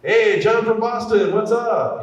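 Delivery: high, bright on-air radio host hello; hyped radio DJ taking a call; upbeat DJ greeting. Retold or imitated. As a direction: greet the caller like a morning DJ.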